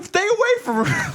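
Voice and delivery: very high, loud fake wail; hammed-up tearful plea; mock crying. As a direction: beg in an exaggerated crying squeal. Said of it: "like, crying in the classroom".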